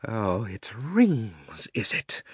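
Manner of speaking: very slow, drawn-out witch voice; crying out as Jadis. A narrator's reading. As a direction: stretch the words out with a swoop of discovery. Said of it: "cried Jadis".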